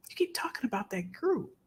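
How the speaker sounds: hushed, confiding therapist; whispered quote. Retold or imitated. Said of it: "even my therapist said".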